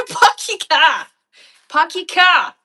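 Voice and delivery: loud, high Boston accent with dropped R's. Shown as a demonstration. Australian showing off for viewers, loud Boston accent. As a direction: shout it in broad put-on Boston. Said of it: "Boston accent doesn't sound too far".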